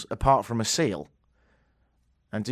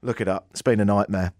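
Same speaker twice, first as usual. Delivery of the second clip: usual speaking voice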